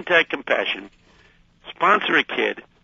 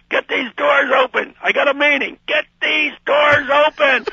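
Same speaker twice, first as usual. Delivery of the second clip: loud, high panicked yell at the doors; 12 semitones higher, 4 dB louder than usual. Retold or imitated. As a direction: bang and yell to be let out. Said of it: "I'm yelling".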